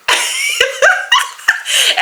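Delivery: high bursting laugh; burst of laughter. Real, not acted. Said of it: "I just bust out laughing".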